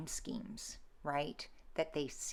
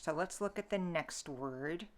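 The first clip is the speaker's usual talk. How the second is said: normal talking voice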